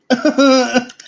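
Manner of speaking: high, loud burst of laughter; real laugh bursts out. Real, not acted. Laughs after chugging the sauce.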